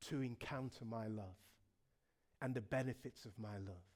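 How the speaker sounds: very low, soft voice, unhurried pauses; tender invitation. Acted. Preacher as God asking for faith, low hushed appeal. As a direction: ask gently, sinking low and intimate.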